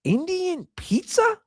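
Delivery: mock incredulous Indian pizza. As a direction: ask it like it's absurd. Incredulous comic question.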